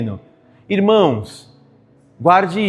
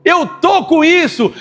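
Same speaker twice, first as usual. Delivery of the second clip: Asaph's confession; 6 semitones higher, slower than usual. Acted. Asaph confessing his envy to God, shouting. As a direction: cry out the urge to stray. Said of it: "É isso que Asaf falou".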